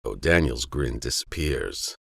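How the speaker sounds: ordinary delivery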